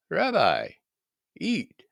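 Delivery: high coaxing voice; urging plea. Acted. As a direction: coax him gently to eat. Disciples urging their teacher.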